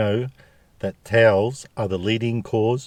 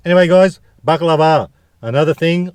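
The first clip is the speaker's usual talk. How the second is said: loud, high hype; excited; 7 semitones higher, 7 dB louder than usual. Real, not acted. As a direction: announce it like a big reveal. Hyped vlog announcement.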